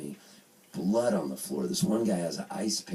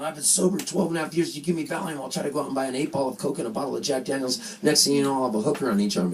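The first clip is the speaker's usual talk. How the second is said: high, rushing comic rant; mock-panicked run-on protest; worked-up protest; 7 semitones higher, 3 dB louder, faster than usual. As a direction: build a frantic refusal without stopping. Acted panicked refusal of Valium.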